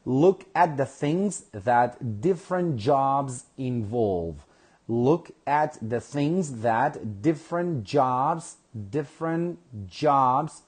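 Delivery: slow, careful reading of the task for learners; slow reading aloud. Shown as a demonstration. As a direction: read it out slowly so the class can follow. Teacher reads the task to class.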